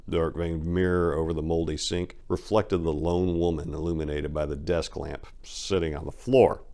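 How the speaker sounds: ordinary delivery, nothing marked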